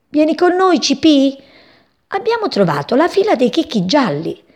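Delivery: voce di passerotto alta e allegra; passeri che chiamano. A narrator's reading. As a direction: chiamalo come un passerotto festoso. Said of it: "lo chiamarono". (voce di passero che chiama l'amico)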